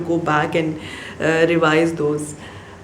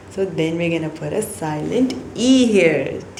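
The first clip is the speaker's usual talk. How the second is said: slow classroom telling; much slower than usual. Shown as a demonstration.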